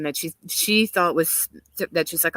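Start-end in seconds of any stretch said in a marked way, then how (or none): none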